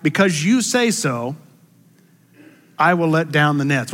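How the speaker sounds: ordinary talk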